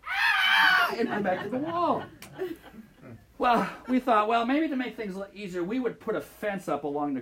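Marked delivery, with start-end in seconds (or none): 0.1-0.9 mock children's shriek, high and loud; kids' scream. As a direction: shriek like frightened first graders. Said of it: "and everyone would go".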